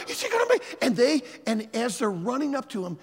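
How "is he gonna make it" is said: eager student. Retold or imitated.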